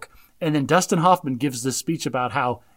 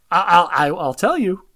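usual speaking voice

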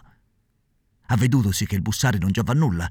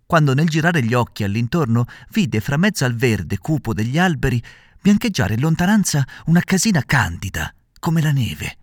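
ordinary delivery, nothing marked

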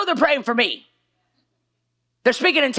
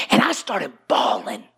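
ordinary delivery

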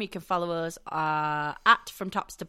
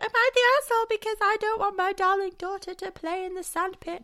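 fussy mum voice; 10 semitones higher than usual. Retold or imitated. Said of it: "arsehole mums that are like".

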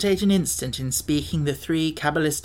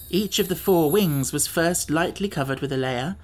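ordinary delivery, nothing marked

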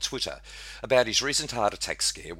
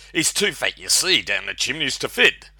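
loud, annoyed reindeer; piqued; 6 semitones higher, 8 dB louder than usual. Acted. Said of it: "said Rudolph with pique".

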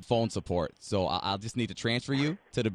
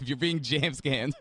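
normal talking voice

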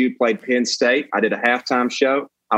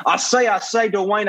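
high-pitched blustering Southern cartoon rooster; drunken Southern bluster, stuttered repeat then declaration; Foghorn Leghorn; 7 semitones higher than usual. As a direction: do a hammed-up cartoon rooster drawl. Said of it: "I'm foghorn leghorn".

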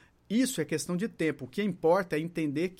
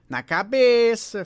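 loud, drawn-out, higher-pitched reply; patient's obvious answer; 4 semitones higher, 9 dB louder, slower than usual. Retold or imitated. Dona Maria tells therapist where it hurts, loud drawn-out answer. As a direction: stretch it out loudly as the patient.

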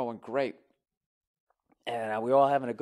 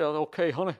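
lightly put-on, raised showman's voice; 5 semitones higher than usual. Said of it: "he's doing all that shit".

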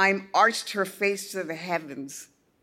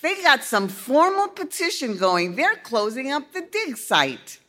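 higher father voice, worried; father, troubled; 8 semitones higher, 4 dB louder than usual. A narrator's reading. Father reading news to daughter, lightly voiced concern. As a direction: tell the bad news uneasily.

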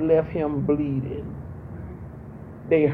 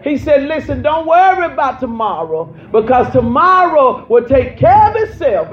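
raised, fervent preacher climbing higher and louder; preaching at full force; 6 semitones higher, 5 dB louder than usual. Acted. Pastor preaching humility to her congregation, full force. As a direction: drive it home to the church, loud and high.

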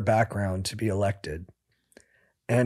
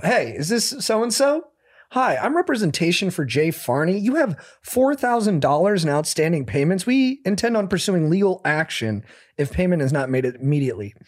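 higher, stiff fake-official lawyer voice; bad lawyer impression; 6 semitones higher than usual. Posing as a friend's lawyer on a phone call, hammed-up official tone. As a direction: play a clumsy lawyer threatening over the phone. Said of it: "I did the worst lawyer impression".

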